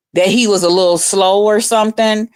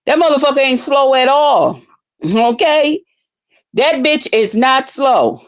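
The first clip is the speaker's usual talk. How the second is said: higher, pressing every word; emphatic insistence; 4 semitones higher than usual. Real, not acted. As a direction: drive the point home hard. Insisting he is not slow.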